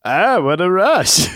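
catchphrase in high, slow mock Minnesotan; Minnesotan accent. Co-host imagining Legion of Doom from Minnesota, mock Minnesotan. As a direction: stretch it into a jokey Minnesota twang. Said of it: "spoke like they were from Minnesota".

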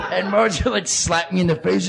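normal talking voice

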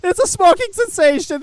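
high, loud mocking echo of the owner; mock shout. Host mocking the shop owner's claim, loud and high. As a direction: shout it back at him in mockery. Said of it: "They're screaming it".

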